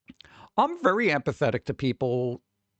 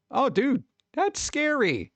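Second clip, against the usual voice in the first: surprised; 12 semitones higher, slower than usual. Real, not acted. Reacting to chat's oven fire.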